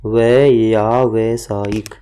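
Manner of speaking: व्यावसायिक drawn out very slowly, syllable by syllable; slow syllable drill. Shown as a demonstration. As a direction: spell व्यावसायिक out slowly for the class. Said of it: "ध्यान रखना". (व्यावसायिक को बहुत धीरे, अक्षर-अक्षर बोलें)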